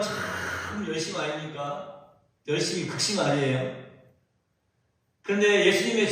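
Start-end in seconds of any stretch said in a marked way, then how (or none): none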